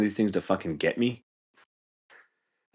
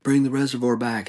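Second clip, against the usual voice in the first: higher protest-chant imitation; raised-pitch mock outrage; mock angry demand; 5 semitones higher, 4 dB louder than usual. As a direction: mimic pissed-off locals demanding action. Mimics angry townspeople's demand.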